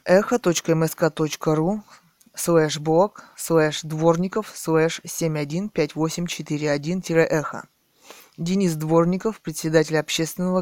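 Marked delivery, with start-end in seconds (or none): 0.0-7.7 dictating. Acted. Reading out a web address.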